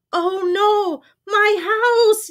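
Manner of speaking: high, loud storybook wail; acted dismayed yell. A narrator's reading. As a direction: yell in alarm as your home falls. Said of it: "yelled Beaver".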